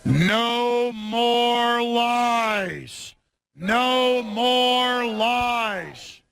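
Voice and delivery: very slow, stretched-out protest chant; long-held bullhorn slogan, heavily drawn out; real slow. Real, not acted. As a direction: drag out every word for the crowd. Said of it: "I gotta say it real slow".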